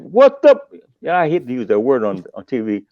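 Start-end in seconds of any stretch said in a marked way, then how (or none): 0.0-0.6 much higher, louder mock yell; retold yell. Yelling at Johnny on the phone, much higher and louder. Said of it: "called and I said".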